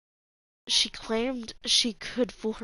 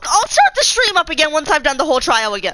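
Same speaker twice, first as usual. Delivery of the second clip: very loud, agitated outburst; upset outburst; 4 semitones higher, 10 dB louder than usual. Real, not acted. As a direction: blurt it out loudly in frustration. Streamer upset, abruptly announces redoing trial.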